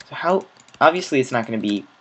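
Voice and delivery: ordinary talk, nothing marked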